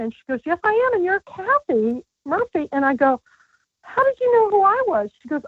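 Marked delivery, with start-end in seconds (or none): 0.4-2.7 higher, lightly put-on voice for Paulina; a hint of a gracious supermodel; imitating Paulina. Retelling supermodel Paulina's reply. 3.8-5.1 higher, astonished question; mock-astonished, much higher; retold amazement. Her own amazed question, retold.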